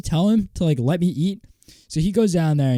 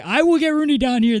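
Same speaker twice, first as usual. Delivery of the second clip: much higher, louder mock-furious threat; angry retold threat; 14 semitones higher, 6 dB louder than usual. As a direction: shout the threat like you lost it at lunch. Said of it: "in spite and anger".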